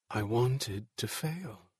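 slow, hushed inner voice; dawning realization. A narrator's reading. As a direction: let it sink in, slowly and softly. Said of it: "he realized".

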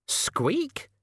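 shrill, very high excited squeak; a gleeful little rat squeak. Acted. Rat cheers after sledge leaves.

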